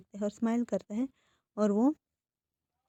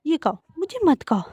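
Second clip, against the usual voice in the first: frustrated; 3 semitones higher, 7 dB louder than usual. Acted. Wing, fed up with Wangji licking his face, exasperated and loud. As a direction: shove the ice cream at him and snap it out, exasperated. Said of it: "frustrate हो जाता है".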